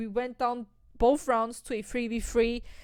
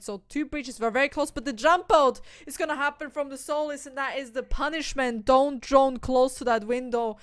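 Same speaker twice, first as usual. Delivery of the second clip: excited play-by-play; 4 dB louder than usual. Acted. Caster calls a sudden kill.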